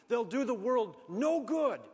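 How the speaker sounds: loud, slow, high exhortation; preacher thundering at the congregation; preaching climax. Real, not acted. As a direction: drive home the final appeal.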